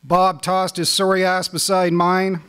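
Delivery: spoken-word poetry reading; poem reading. Acted. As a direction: read it like a performed poem, building with the story. Reads his hockey poem.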